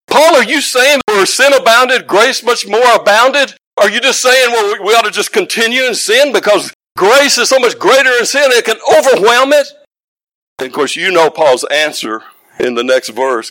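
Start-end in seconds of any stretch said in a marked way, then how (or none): 0.1-9.7 high, loud questioning; incredulous challenge. Acted. Objectors challenging Paul's teaching on grace, incredulous. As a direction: press Paul with disbelieving questions.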